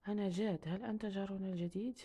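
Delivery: ordinary delivery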